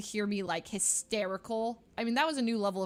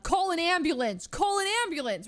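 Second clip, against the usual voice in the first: high, loud cry for help; panicked yell; 9 semitones higher, 7 dB louder than usual. Retold or imitated. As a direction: re-enact frantic yelling for help. Retelling her panic on finding pet.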